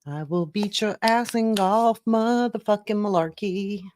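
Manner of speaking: high put-on voice sending up the debaters; lightly hammed-up candidate impression; mocking old candidate voice. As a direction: parody the golf brag in a high mock voice.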